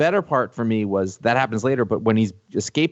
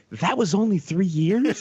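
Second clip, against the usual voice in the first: slow, raised-pitch astonished question; stunned disbelief; 4 semitones higher than usual. Retold or imitated. Fan realizing how short the series' run was, stunned disbelief. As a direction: stretch it out, unable to believe it.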